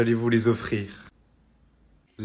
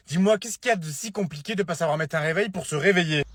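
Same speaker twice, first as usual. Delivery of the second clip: ordinary delivery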